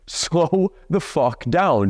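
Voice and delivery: slowed-down command; drawn-out coaching order; slow, emphatic. Shown as a demonstration. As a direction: spell it out word by word, very slowly. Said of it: "You don't need to say".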